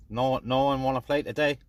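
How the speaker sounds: ordinary talk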